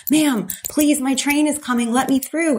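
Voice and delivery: high, urgent begging; panicked pleading. Retold or imitated. She begs the train attendant to let her through, high and panicked. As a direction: beg the attendant with rising panic.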